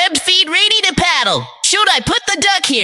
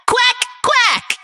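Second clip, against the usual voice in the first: duck quack; 9 semitones higher than usual. Shown as a demonstration. Teacher voicing duck sound.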